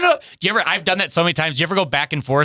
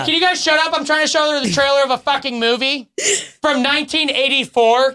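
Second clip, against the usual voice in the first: mock hushing yell; 10 semitones higher than usual. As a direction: shush the whole bar. Acting bar guy hushing the room.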